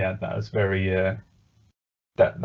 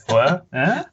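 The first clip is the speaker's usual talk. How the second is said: lightly hammed-up puzzled squawk, high and loud; imagined candle character; 6 semitones higher, 4 dB louder than usual. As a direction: pitch it up in mock bafflement. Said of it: "no candle there to kind of be like".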